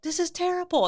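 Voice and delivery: high-pitched mock lament; kids' dismay. Kids after the dare, high dismayed protest. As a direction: imitate distraught kids complaining.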